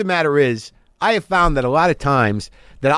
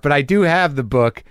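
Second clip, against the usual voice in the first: ordinary talk, nothing marked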